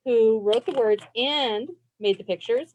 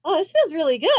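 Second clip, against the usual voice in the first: higher, pleased sigh into the words; enjoying the stretch; 5 semitones higher, 3 dB louder, much faster than usual. Real, not acted. Teacher stretches with the children, pleased and higher. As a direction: let the stretch feel good in the voice.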